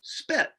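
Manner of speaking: teacher blending the word spit; blended word. Shown as a demonstration. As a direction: spit said whole, high and quick. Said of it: "say the sounds as you go".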